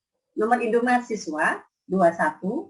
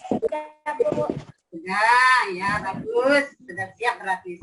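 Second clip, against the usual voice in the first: kindergarten teacher voice; 3 semitones higher, 4 dB louder than usual. Acted. Teacher talking to children online.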